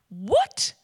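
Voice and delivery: aghast, high, loud cry; high-pitched, horrified outburst; Much Afraid. Acted. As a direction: blurt it out in acted alarm. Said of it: "And Much Afraid is like".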